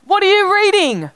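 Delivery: high-pitched calling out; retold yell. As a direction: imitate a dad calling to the back seat. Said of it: "just yells out to her".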